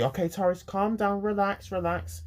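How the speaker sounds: high, repeated reassurance; calming teacher. Retold or imitated. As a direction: voice a teacher urging a pupil to relax. Retold staff calming the speaker.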